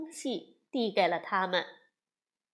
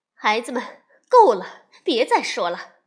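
louder stern mother; firm scolding; 11 dB louder than usual. Acted. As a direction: cut the children off firmly. 妈妈制止孩子. (妈妈大声制止孩子们：够了)

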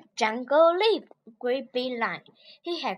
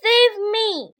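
high, loud cry for help; lion calling out from the river; 7 semitones higher, 6 dB louder than usual. Acted. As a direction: yell help as you're swept away. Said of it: "said Lion".

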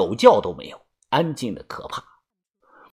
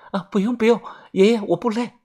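higher, breathy hasty refusal; timid young woman; 7 semitones higher, faster than usual. A narrator's reading. Xiaoxia declines grandpa's offer, timid higher voice. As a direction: wave it off nervously in a small voice.

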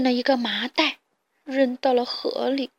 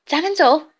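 curt; 5 semitones higher, 7 dB louder than usual. Acted. Carmen leaves in disgust.

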